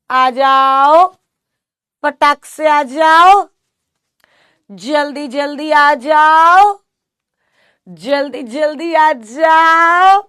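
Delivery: loud, slow sing-song hurry-up; loud urging call. Real, not acted. Teacher loudly urging her students to join quickly. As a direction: draw it out and call them in fast.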